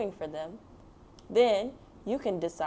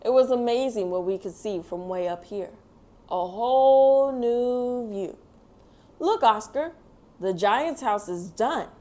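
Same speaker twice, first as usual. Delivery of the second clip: put-on gentle storybook narration read to kids; reading to children; 5 dB louder than usual. A narrator's reading. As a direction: tell it like a bedtime picture book. Reads picture book aloud.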